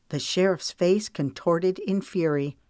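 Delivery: usual speaking voice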